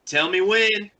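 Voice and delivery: high, loud shout; scream. Acted. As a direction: yell it across the ship as a signal. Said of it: "and scream".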